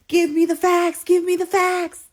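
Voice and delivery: high, loud chanted demand; insistent demand. Retold or imitated. Demanding proof from boyfriend, loud and high.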